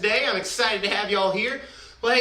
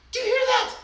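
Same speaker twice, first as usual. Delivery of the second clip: excited discovery; 12 semitones higher, much faster than usual. Acted. Leader points out sound to kids.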